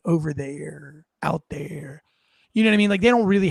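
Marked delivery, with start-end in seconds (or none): 0.1-2.0 wishy-washy evasive answer. Retold or imitated. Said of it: "wishy-washy bullshit".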